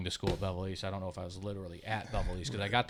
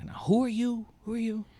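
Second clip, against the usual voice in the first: put-on much higher, lost old man asking; confused old uncle; 14 semitones higher, 7 dB louder than usual. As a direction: play the bewildered uncle who knows nobody. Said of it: "my Uncle Ray would look at my dad and say".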